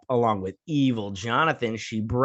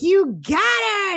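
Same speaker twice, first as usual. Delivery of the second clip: very high, loud, drawn-out squeal; mock cheer; 7 dB louder, much slower than usual. Voicing her excited cheer.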